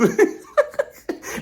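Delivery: airy laugh jumping high; unvoiced giggle; breathy high laugh. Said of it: "Lisa's voice sounds like this".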